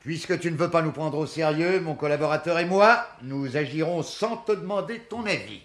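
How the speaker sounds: stung friend snapping back at sarcasm; offended retort. Acted.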